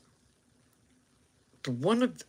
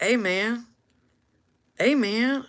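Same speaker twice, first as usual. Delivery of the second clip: higher, friendly call; 7 semitones higher, slower than usual. Retold or imitated. Calling after the gang member, friendly higher hail. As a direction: hail a stranger up the street. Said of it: "So I was like".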